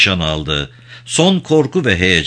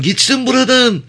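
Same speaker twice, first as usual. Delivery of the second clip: very high, shrill panicked yell; minister's high-pitched desperate cry. A narrator's reading. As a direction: shriek it in a squeaky, frightened voice. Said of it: "diye haykırdı bakan".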